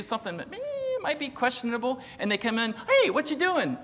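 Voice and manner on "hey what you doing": high, chirpy mock-child question; interrupting child. Children walking in on a parent, inquisitive. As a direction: pipe up like a kid barging in.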